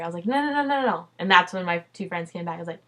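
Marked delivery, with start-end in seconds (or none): 0.3-1.1 higher, louder string of no's; mock panicked insisting; frantic protest. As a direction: refuse to be put off, insistent. Insisting on telling her now.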